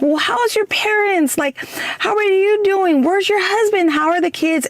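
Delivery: nervous small talk. Retold or imitated. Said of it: "trying to get a word in".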